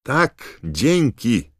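loud, high shout over the shoulder; shouted back. A narrator's reading. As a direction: toss a reply back at full call. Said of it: "krzyknął Ron przez ramię". (głośny, wysoki okrzyk przez ramię)

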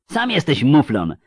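high, loud retort; indignant yell. A narrator's reading. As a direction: snap back at the doubter. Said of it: "wrzasnęła Anguiem". (oburzony wrzask)

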